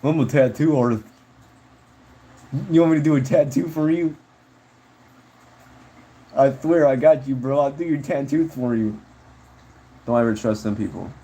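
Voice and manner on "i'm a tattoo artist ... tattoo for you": lightly mocked would-be tattoo artist; cocky amateur tattooist. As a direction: play an overconfident amateur, lightly put on. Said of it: "don't be them people that get tattoo kits off eBay and go".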